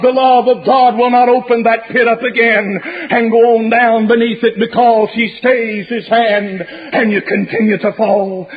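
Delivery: ordinary talk, nothing marked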